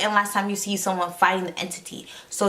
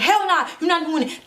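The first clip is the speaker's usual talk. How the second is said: high, sassy no-way; flat refusal; 8 semitones higher than usual. Retold or imitated. Mallory refusing to let the boy look, mock sassy. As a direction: shut the kid down, much higher. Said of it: "Mallory's like".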